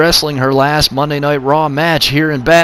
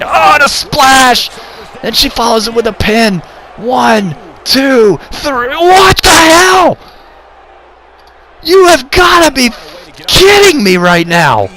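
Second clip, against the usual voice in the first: higher, loud, disbelieving yell; excited shouting; 7 semitones higher, 3 dB louder than usual. Real, not acted. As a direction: scream the count and the shock. Shock finish during near-fall.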